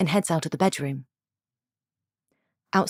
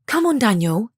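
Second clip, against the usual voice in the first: hurrying Isabel; 5 semitones higher, 3 dB louder than usual. Acted. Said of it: "Isabel hurries him".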